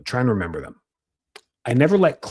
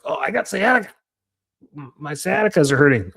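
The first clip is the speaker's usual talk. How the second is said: high-pitched patient impersonation; mock complaining patient; 5 semitones higher, 3 dB louder than usual. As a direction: play up the patient's self-diagnosis. Mimics patients overclaiming sciatica.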